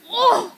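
loud, high startled "oh"; startled shriek. Real, not acted. Gamer startled in mid-fight, startled shriek. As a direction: cry out in alarm.

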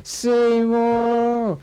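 high, long drawn-out call of Seymour; Chalmers calling. Retold or imitated. Chalmers calling out for Skinner, high and drawn out. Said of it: "So then it was".

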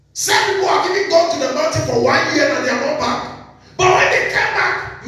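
high, driving sermon voice; preaching. Acted. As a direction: preach it out to the church.

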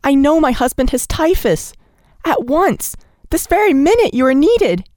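high, pressing plea; urgent insistence. A narrator's reading. Nellie insisting to the doctor, high and urgent. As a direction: demand he come at once.

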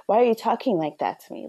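ordinary talk

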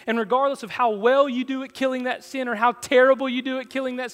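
high, loud, driving sermon voice; full-force preaching. Real, not acted. Preacher assuring church they're never pushed from the table, full-force preaching. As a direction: preach it high and loud, building to a long last word.

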